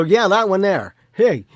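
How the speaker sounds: high, loud pointing-out call; loud playful call. Retold or imitated. Spirit friend points something out to Mark, loud. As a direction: shout to get his attention.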